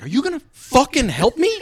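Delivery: high, loud mock rage at the corporal; yelling up for help, fed up; hammed-up furious shout; angry yell. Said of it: "I'm like".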